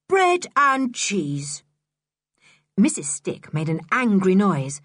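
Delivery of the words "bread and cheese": very slow, high, word by word; emphatic repeat. A narrator's reading. Boy rubbing it in to Missus Stick, slow taunt. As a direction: spell out the offer with relish.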